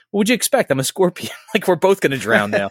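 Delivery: high, mock-innocent scorpion voice; lightly voiced scorpion with a laugh; scorpion's retort. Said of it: "and then the scorpion's like".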